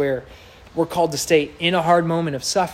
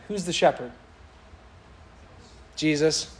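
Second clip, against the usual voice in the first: normal talking voice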